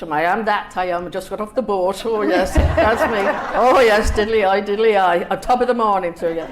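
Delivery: loud, laughing stage Irish brogue; hammed-up stage Irish. Author hamming up stage Irish for welcoming Americans, loud and laughing. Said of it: "Oh, you're Irish".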